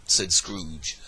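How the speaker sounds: ordinary delivery, nothing marked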